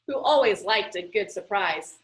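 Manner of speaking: ordinary delivery, nothing marked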